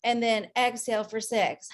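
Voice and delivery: slow counted breathing cues; guided breathing. Shown as a demonstration. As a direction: pace their breaths, calm and slow. Leads a breathing exercise.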